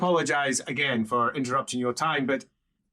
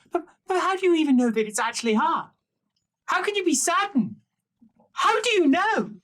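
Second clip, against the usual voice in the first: put-on nervous boy's voice, pitched up; frantic young squire pressing his doubts; high anxious youth; 3 dB louder than usual. Young boy questions the Emperor.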